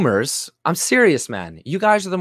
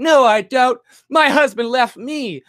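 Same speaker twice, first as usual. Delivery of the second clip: much higher mock voice of a jilted woman, louder; 7 semitones higher, 3 dB louder than usual. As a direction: ape her protest, pitched way up. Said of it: "you're just like a woman who can't fucking take it".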